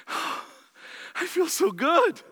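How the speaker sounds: slow, high, sighing self-satisfaction; smug contented sigh. Pretend. Preacher playing his smug self, slow pleased sigh. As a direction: savour it like a self-pleased saint. Sighing smugly after overcoming temptation.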